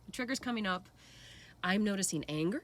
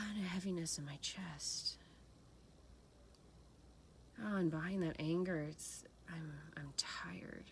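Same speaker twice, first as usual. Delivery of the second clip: soft meditative whisper; 7 dB quieter than usual. Shown as a demonstration. Self-inquiry meditative inner voice.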